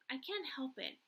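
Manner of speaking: ordinary delivery, nothing marked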